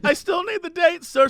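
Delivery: put-on high-pitched lady with a slight German accent. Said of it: "sort of has a German accent".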